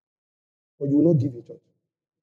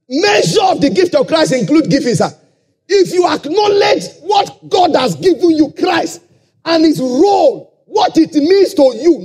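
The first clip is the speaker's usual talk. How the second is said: preaching at full force; 7 semitones higher than usual. Acted. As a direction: hammer the point home to the church.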